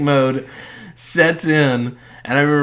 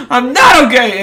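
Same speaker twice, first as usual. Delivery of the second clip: panicked shout; 12 semitones higher, 11 dB louder than usual. Retold or imitated. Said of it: "I'm like".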